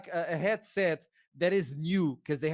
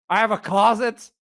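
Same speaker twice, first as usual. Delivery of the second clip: raised, loud disbelief; astonished question; 6 semitones higher, 9 dB louder than usual. Retold or imitated. Passenger learns of a first-class closet, astonished. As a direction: blurt it out higher, incredulous. Acts out surprise at menu and closet.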